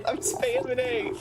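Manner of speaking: very high, squealed plea; comic panic. Pretend. Gamer to viewers, unable to skip, squealing comic panic. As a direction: squeak it helplessly, half laughing.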